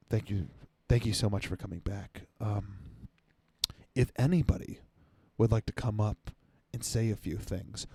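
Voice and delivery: soft, low, gentle host voice; impression of the host. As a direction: mimic a mellow meditation host, hushed. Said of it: "this is my impression of the guy".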